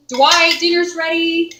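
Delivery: calling out loud to another room; yelling across the room. Real, not acted. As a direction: call him to dinner from the kitchen. Calling husband to dinner.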